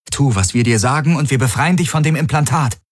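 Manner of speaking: harsh. Acted. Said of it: "mit aller Härte".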